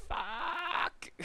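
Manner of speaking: swearing after taking fall damage; frustrated swear. Retold or imitated. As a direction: yelp it in annoyance. Fell and took damage.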